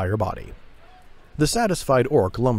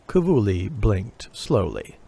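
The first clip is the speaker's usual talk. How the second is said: usual speaking voice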